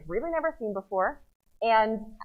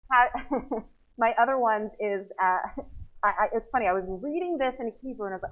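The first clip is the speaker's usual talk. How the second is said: ordinary delivery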